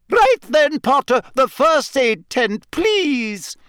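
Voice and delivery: high-pitched professor calling out an order; brisk professor. A narrator's reading. Professor sending Potter to first aid tent, commanding.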